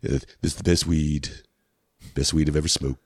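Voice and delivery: low, drowsy presidential parody; stoned Donald Trump. Acted. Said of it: "I'm like a stone Donald Trump".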